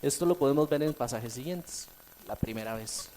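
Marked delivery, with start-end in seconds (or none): none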